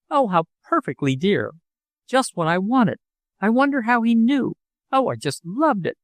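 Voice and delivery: high, gushing delight; thrilled with the present; delighted cry. A narrator's reading. Daughter unwrapping the gift, gushing high delight. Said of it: "she cried".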